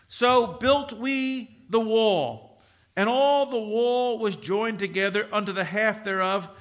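solemn Bible reading; scripture reading. Acted. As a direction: read it out like holy writ.

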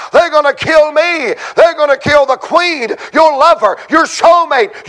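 high, urgent pulpit plea; impassioned preaching. Acted. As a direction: cry it out like a revival preacher. Preaches Esther's plea at full force.

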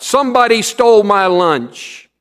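put-on loud, high boom of an angry boy. A narrator's reading. Big Tom booming to the class about his lunch, loud. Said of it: "Big Tom's voice boomed out".

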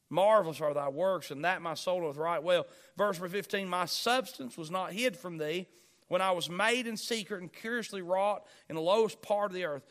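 solemn pulpit reading; scripture reading. Acted. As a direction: proclaim the verses to the congregation. Reads scripture aloud.

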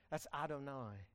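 ordinary talk

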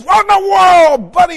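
imitating angry man. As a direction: act out the furious driver yelling. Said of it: "He's angry".

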